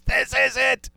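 high-pitched teasing imitation of excitement; mock excited guest. Imitates guest getting into game talk.

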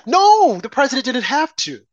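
a sudden high, loud No; emphatic no. Real, not acted. Host cutting off the quoted official with a high, loud No. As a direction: burst out No in flat denial.

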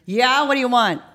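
lightly voiced man calling through a speaker; man on intercom. Man on intercom answers the doorbell, calling out. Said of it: "through the speaker, we hear".

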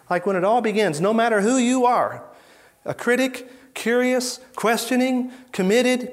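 high, loud, rising preacher's build; preaching at full force. Real, not acted. Preacher to congregation, listing everyone Jesus welcomed, high and loud.

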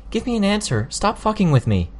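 high, demanding and angry. Acted. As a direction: insist on an answer, fed up. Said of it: "I raised my voice again".

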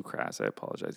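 soft whispered mock apology. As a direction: breathe out a sheepish sorry.

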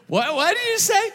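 high-pitched, confused asking; straining listener. Acted. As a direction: act out not hearing a word.

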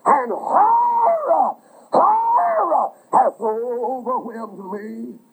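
slow lament that cries out then sinks; anguished cry. Acted. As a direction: let the voice break on the repeated word. Preacher voicing David's anguished prayer.

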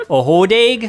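high laughing mock Wisconsin "hodag"; Wisconsin accent. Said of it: "You have to think of it with a Wisconsin accent".

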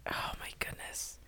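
hushed, airy whisper; whispered dismay. Acted. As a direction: whisper it like a dreading inner thought. Imagines dread at breaking bad news.

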